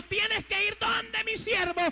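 high, slow, hammering proclamation; full-force preaching. Acted. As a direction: thunder the charge out slowly on a high pitch. Preaches God's command at full force.

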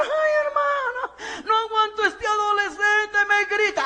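high, slow, half-crying lament of a worn-out parent; wailing parent. Retold or imitated. Desperate parents complaining to the preacher about their teen, high and wailing. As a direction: cry out like a parent at the end of their rope.

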